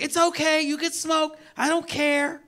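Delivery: mock girlfriend telling him he can smoke. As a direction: play the easygoing girlfriend. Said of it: "they're like".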